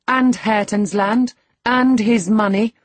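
high, loud taunting; loud, provoking young woman; reckless defiance. A narrator's reading. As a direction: press on boldly, pitched high. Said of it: "pursued the reckless thing".

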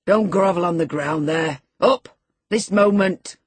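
loud, impatient command; shouting. A narrator's reading. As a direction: shout the order, punching each phrase. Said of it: "he shouted".

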